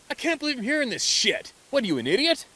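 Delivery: high, loud indignant rant; hammed-up angry scoffing at someone; mock outrage. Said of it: "get angry and go".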